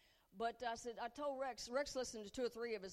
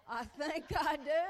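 gushing confession; 4 semitones higher, 8 dB louder than usual. Retold or imitated. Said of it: "Dear Lord, I think I do".

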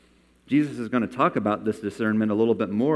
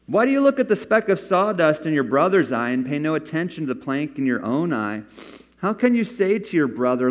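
loud, high, emphatic Bible reading; scripture reading; 6 semitones higher, 5 dB louder than usual. Acted. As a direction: declaim the rebuke with force, easing at the close. Reads Jesus's rebuke from scripture.

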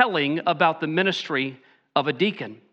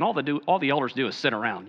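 fast, low, softer mocking of a complaint; quick lowered mock gripe; mock dismissive grumble; 5 semitones lower, 4 dB quieter, much faster than usual. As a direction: toss it off like a scoffing churchgoer. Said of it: "I don't want to hear you guys saying".

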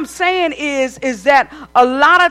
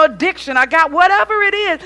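normal talking voice

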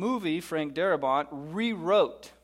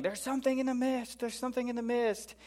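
high-pitched, hushed imitation yell; soft mock scream, pitched way up; 9 semitones higher, 4 dB quieter than usual. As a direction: retell a panicked warning in a high, held-back voice. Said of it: "they're yelling".

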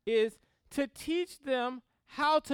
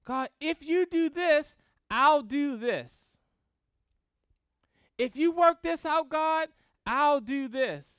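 mock bargaining plea; 5 semitones higher, 6 dB louder than usual. Preacher acts out believers bargaining with God, loud mock plea. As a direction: haggle with God in earnest tones. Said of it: "bargain with God".

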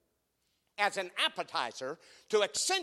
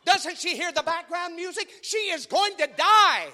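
much higher, louder hammed-up panic; mock panic; 13 semitones higher, 11 dB louder than usual. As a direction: shriek at the screen in mock fright. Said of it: "this is me. I'm like this".

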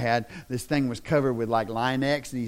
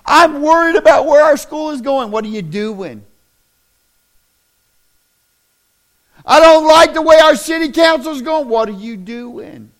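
loud, high, driving sermon voice; fiery pulpit delivery; full-force preaching; 14 semitones higher, 15 dB louder than usual. Acted. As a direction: preach it hard to the congregation.

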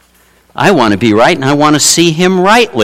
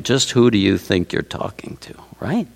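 hushed, pointed whisper; a rebuking whisper to the congregation; stern whisper; 2 semitones lower, 9 dB quieter than usual. Acted. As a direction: whisper it like a warning from above. God's imagined rebuke to presumption.

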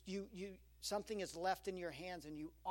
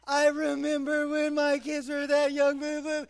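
put-on loud, high gushing old-timer voice; 8 semitones higher, 17 dB louder than usual. Gushing at a stranger's baby, loud and high.

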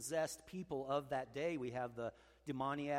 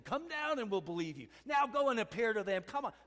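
higher, louder jeering challenge; mocking taunt; 7 semitones higher, 6 dB louder than usual. Preacher voicing the mockers, jeering and higher. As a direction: sneer come down and prove it. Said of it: "'Cause they were mocking him, saying".

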